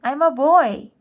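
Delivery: high, loud insisting; loud protest. A narrator's reading. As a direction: shout it out, indignant. Boy insists to ant queen.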